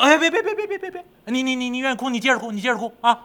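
high, rapid-fire pleading; comic pig in a high fluster; panicked backpedalling. Acted. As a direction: backpedal fast in alarm. Pig panics at being steamed.

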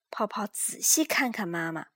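ordinary delivery, nothing marked

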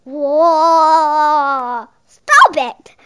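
loud startled shout; Jack's sudden loud cry. A narrator's reading. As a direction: yell it out in alarm. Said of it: "said Jack".

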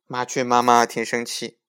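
ordinary delivery, nothing marked

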